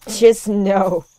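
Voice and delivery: loud, drawn-out protest; fed up and very loud; loud exasperation. Real, not acted. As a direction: exclaim it in slow, emphatic disbelief. Gamer protests at scary house.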